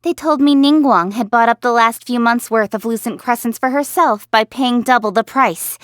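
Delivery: normal talking voice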